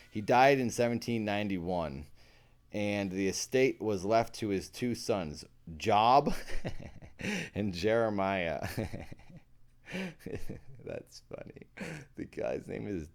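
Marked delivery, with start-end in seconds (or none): 6.4-7.5 breathy giggle. Real, not acted. Cracking up at name.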